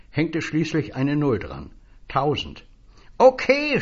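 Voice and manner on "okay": high, loud yelled okay; a piercing little shout. Acted. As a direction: shout it right into his ear. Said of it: "schrie das Männchen".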